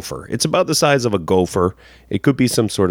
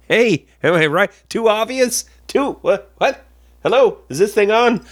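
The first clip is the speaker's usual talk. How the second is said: mock stand-up comic; 9 semitones higher than usual. Joke falls flat, mock comedian.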